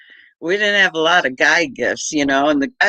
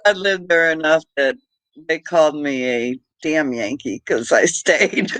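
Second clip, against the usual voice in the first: normal talking voice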